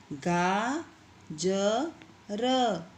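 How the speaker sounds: गाजर stretched very slowly, syllable by syllable. Shown as a demonstration. Teacher to pupils, sounding out गाजर very slowly. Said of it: "इसी तरह से ये आगे के जो शब्द हैं".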